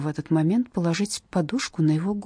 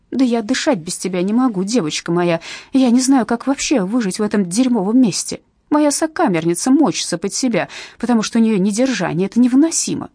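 loud, indignant outburst, higher; indignant mother; 4 semitones higher, 5 dB louder than usual. Acted. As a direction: rail against this wretched place. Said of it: "глаза вспыхнули с негодованием".

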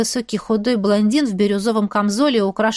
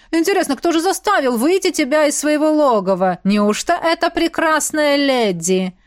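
higher, drawling theatrical baron; Седрик Тодж; 6 semitones higher than usual. A narrator's reading. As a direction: tease grandly, stretching the final words. Baron's voice in story.

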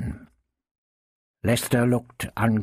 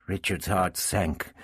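usual speaking voice

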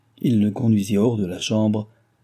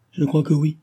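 half smiling; 6 semitones higher than usual. A narrator's reading. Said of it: "dit Cécile en souriant à demi".

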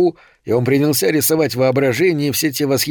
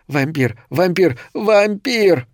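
each repeat higher, the last a drawn-out cry; mounting alarm; 6 semitones higher, slower than usual. A narrator's reading. Horror word pounding in the boy's head, mounting alarm. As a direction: build from a normal voice to a high cry.

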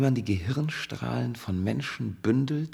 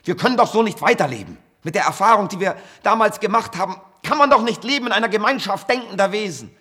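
rising louder and higher into a desperate plea; impassioned plea; 8 semitones higher, 7 dB louder than usual. Acted. Scientist begs Konstantin and Marga to help him, building to a desperate cry. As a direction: beg them, voice climbing to breaking. Scientist pleads with his friends.

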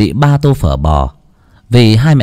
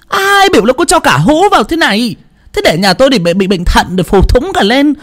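shouting mother-in-law; 14 semitones higher than usual. A narrator's reading. Mother-in-law shouts at her daughter-in-law, furious. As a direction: rant at her over the spilled salt. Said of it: "mà quát".

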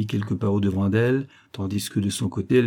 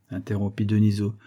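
usual speaking voice

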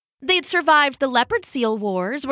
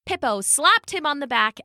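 usual speaking voice

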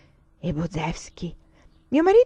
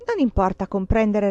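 ordinary delivery, nothing marked

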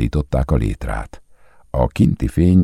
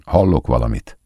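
usual speaking voice

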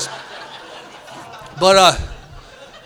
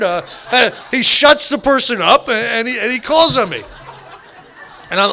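ordinary delivery

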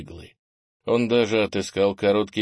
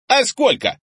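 put-on very high, loud child's voice; cheeky boy; 8 dB louder than usual. A narrator's reading. Boy challenges his grandfather, high-voiced retort. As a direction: shoot the question back. Boy shouts back in quarrel.

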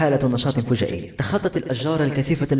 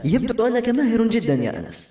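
high-pitched admiring praise; impressed, raised-pitch compliment; 10 semitones higher than usual. A narrator's reading. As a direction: lift the voice high, clearly impressed. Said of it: "وقالت بإعجاب".

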